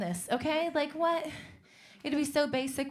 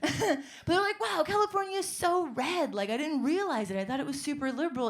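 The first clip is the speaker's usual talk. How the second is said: higher, surfer-dude Owen Wilson wonder; 5 semitones higher, 3 dB louder than usual. Retold or imitated. Comic voices transplant friends as Owen Wilson, higher and amazed. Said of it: "that Owen Wilson voice".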